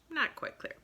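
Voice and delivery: secretive whisper. A narrator's reading. Secret package handoff narrated.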